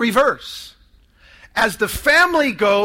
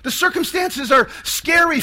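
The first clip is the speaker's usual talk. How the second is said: ordinary delivery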